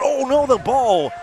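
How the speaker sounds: high, loud, slow groan of dismay; dismayed surprise. Real, not acted. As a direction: react as the ball goes wrong. Ball bounces badly, live call.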